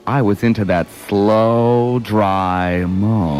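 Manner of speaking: theatrical verse, drawing out the last words; poem recitation. Acted. Partner takes a turn at the bawdy poem, poem recitation. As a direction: recite it, slowing into the final phrase.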